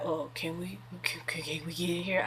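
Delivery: lightly voiced meek ex, soft; sheepishly ask, hushed and small; timid ex. Mimicking her ex sheepishly asking to join the line, soft.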